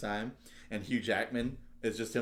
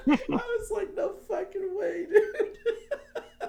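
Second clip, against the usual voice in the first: high squeaky words through laughing; cry-laughing the quote out; laughing to tears; 6 dB louder, much slower than usual. Real, not acted.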